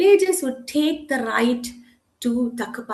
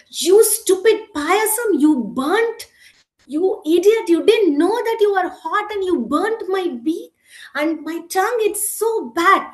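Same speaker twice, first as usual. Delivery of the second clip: high, raised-voice scolding; angry shouting; 6 semitones higher, 4 dB louder than usual. A narrator's reading. Bird scolding the payasam that burnt her, angry shouting. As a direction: berate it indignantly, pitched up. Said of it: "was very angry and she shouted".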